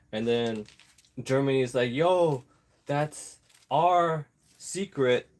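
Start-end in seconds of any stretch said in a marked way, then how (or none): 1.9-5.3 high, louder, slow indignant complaint; mock German protest. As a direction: act offended that the secret was taken. Germany voiced protesting stolen secrets.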